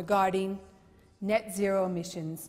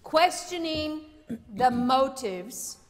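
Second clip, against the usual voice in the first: ordinary talk, nothing marked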